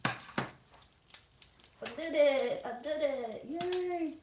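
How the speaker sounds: high, delighted little victory cry; giddy yay of success; excited cheer. Real, not acted. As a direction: celebrate pulling it off. Celebrating eggs out unbroken.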